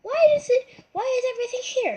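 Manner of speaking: very high whiny cat voice; squeaky cat voice. Acted. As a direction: give the cat a tiny squeaky voice. Voicing the cat's thoughts.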